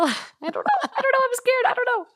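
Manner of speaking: very high laughing squeal; flustered laughing. Real, not acted. Reader unsure how to say the word, flustered high-pitched laughing. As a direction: squeak it out helplessly through laughter. Panics laughing over the word's pronunciation.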